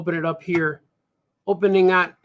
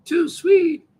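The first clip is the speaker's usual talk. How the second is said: Graham agreeing; 6 semitones higher, slower than usual. Acted.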